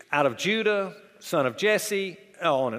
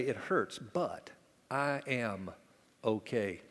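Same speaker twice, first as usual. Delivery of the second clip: quiet brave admission; 5 semitones lower, 8 dB quieter, slower than usual. Retold or imitated.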